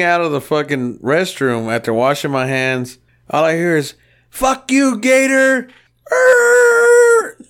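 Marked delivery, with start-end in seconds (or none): 4.3-5.7 high-pitched mock holler; retold yell. Storyteller mimics Art yelling at him from the shop floor, high mock yell. 6.3-7.4 loud, very high screech; tire screech imitation. Storyteller imitates Art's burnout in the new truck, loud screech.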